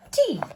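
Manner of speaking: a sudden high shriek of terror on the last word; terrified scream. A narrator's reading. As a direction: scream the word out as you see the T-rex.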